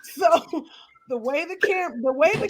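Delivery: high and loud, drawn out, then a laugh; laughing through it. Real, not acted. Telling cohosts her neck looks pre-diabetic, laughing loudly. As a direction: stretch the word slowly, then crack up.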